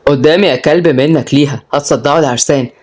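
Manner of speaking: put-on mildly annoyed order to the kids; irritated. A narrator's reading. Galal shooing his kids from the couple, irritated. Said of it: "قال ابوهم جلال بغيظ".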